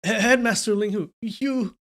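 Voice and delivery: high, stammering plea; frightened calling out. A narrator's reading. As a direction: call out in a worried, faltering voice. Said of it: "he quickly called out".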